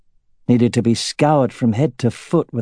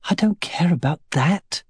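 muffled against a shoulder; 8 semitones higher than usual. A narrator's reading. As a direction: speak into his shoulder. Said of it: "her voice muffled against his shoulder".

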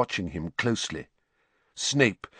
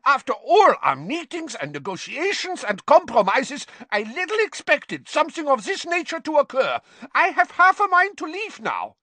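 furious tirade climbing very high; exploding; 4 dB louder than usual. A narrator's reading. Karkaroff threatening to leave after all the meetings, exploding. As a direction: rant at a very high pitch, threatening to go. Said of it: "exploded Karkaroff".

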